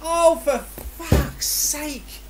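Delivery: ordinary delivery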